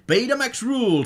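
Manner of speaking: mock cheer. Quoting a friend's Betamax slogan, high mock cheer. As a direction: squeal it like a fan's rallying cry. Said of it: "says Vatsila".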